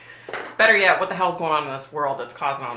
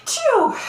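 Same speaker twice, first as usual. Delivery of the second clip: big real sneeze; loud sneeze; 8 dB louder than usual. Real, not acted. Real sneeze on live stream.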